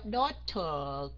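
"daughter" slow, with a puffed, crisp T; British T. Shown as a demonstration. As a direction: sound the T clearly in "daughter". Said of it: "Đọc theo giọng Anh cũng được".

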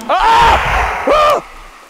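loud high shrieks; real panicked screaming; terrified screams. Real, not acted. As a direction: scream twice in fright. Jump scare in game.

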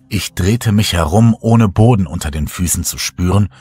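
usual speaking voice

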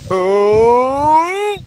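long "oh" climbing high and loud; drawn-out rising howl; rising cry. Real, not acted. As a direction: wail upward as things go wrong. Player in danger, long cry.

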